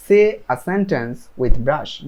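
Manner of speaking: each word slow and separate; slow model sentence. Shown as a demonstration. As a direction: spell it out slowly for learners. Teacher models English instruction.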